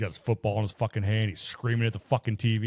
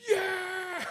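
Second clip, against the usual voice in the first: retold kid scream; 5 dB quieter than usual. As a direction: cheer strillato da lontano, imitato. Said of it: "you can hear him screaming".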